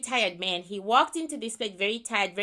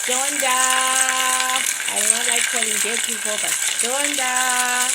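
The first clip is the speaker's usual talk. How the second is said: calling out a name; 3 dB louder than usual. Real, not acted. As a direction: summon her firmly by name. Calling the dead spirit by name.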